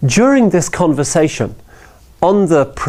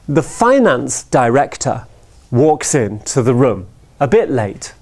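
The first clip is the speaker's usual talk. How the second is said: ordinary talk, nothing marked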